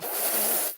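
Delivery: long hissing "fff"; overblown F. Shown as a demonstration. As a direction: push a hard, sustained F through the lip. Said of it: "You don't want".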